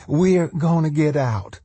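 high-pitched insistent woman; interrupting. A narrator's reading. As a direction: cut in urgently in a high voice. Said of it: "Darlene interrupted".

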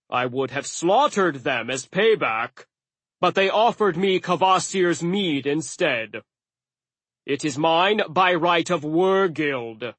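an indignant giant arguing his case; aggrieved giant. Acted.

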